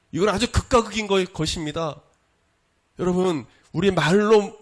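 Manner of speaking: urgent, high-pitched exhortation; forceful preaching. Real, not acted. Preacher urging the congregation to wake up and hear, forceful preaching.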